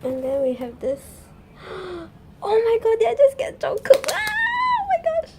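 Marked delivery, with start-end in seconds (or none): 1.1-2.4 high, hushed gasp of delight; astonished intake of breath; shocked gasp. Real, not acted. Sees a surprise item in unboxing. 2.4-5.3 very high, loud fan scream; real squealing shout of disbelief; excited screaming. Real, not acted. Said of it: "I need to keep calm".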